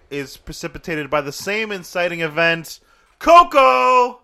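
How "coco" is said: loud, high, sung-out Coco!; excited Coco shout. Acted. Cohost blurts the movie name again, loud and high. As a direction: belt the title like a jingle.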